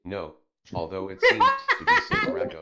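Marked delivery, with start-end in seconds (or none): 1.2-2.6 high, loud laughter through words; laughing. Real, not acted. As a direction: crack up while reading. Cracking up at Sakurako's message.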